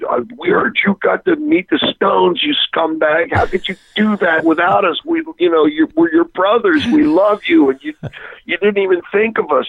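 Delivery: high, whiny mock complaint from the band; whiny bandmates. Bandmates guilting him over meeting the Stones, mock whine.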